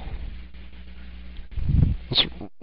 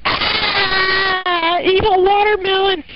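a high, shrill scream at evil watermelon; mock terrified shriek; slower than usual. Kid screaming at his watermelon in mock terror. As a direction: shriek in fake horror.

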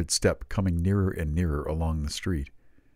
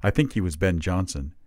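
ordinary delivery, nothing marked

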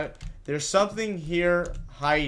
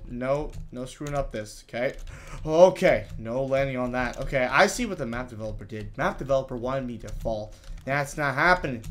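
ordinary talk, nothing marked

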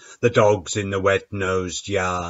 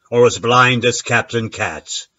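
ordinary delivery

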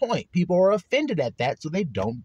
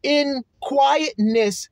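"quietness" stretched very slowly; slow emphasis; 7 semitones higher, much slower than usual. Shown as a demonstration. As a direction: draw out "In quietness" word by word. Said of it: "I'm emphasizing it".

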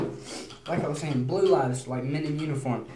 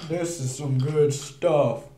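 stoner voice; 3 dB louder, much slower than usual. Retold or imitated. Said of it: "people going".

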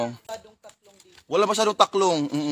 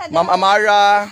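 calling out; 3 semitones higher, 7 dB louder, slower than usual. Real, not acted. Calling out viewer's name.

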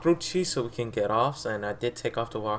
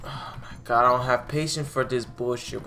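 usual speaking voice

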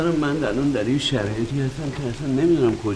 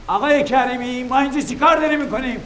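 high urgent call, fast; 9 semitones higher than usual. Acted. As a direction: call across the place to find him. Calls out for Mr. Karimi.

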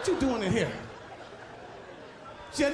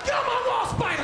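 high, yelled get off my wall; stuck on the toilet, yelling at a spider; shouting at spider; 12 semitones higher than usual. Retold or imitated.

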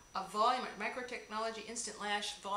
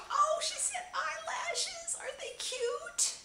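much higher, louder, slowed squeal of delight; excited squeal; 6 dB louder, slower than usual. Real, not acted. Unboxing a friend's gift, squealing with delight. Thrilled at eyelashes in box.

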